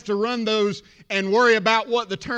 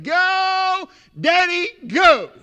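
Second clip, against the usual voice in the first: put-on high, loud child's cheer; little girl cheering; 7 semitones higher, 5 dB louder, much slower than usual. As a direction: call out like a daughter cheering Daddy on. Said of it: "I heard one little voice".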